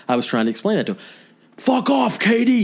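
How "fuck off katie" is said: high, hammed-up furious yell; yelling neighbor. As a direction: bark it through the door at her. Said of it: "he yells through the door".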